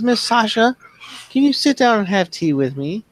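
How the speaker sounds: put-on high, sweet little-girl voice. Little girl inviting Tasha to her tea party, high and sweet. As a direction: ask politely like a tiny child. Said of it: "She says".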